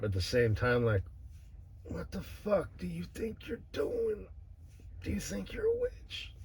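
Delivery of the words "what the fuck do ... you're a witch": playful mock scolding, pitch climbing much higher; light and playful. Scolding his future wife about witchcraft, playfully. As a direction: tease her like a scolding you don't mean. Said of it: "light and playful about it".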